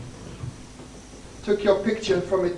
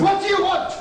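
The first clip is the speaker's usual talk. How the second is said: higher, sharp demand; irritated snap; 8 semitones higher than usual. Acted. Lieutenant interrupted at prayer, irritated higher demand. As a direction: snap at the intruder.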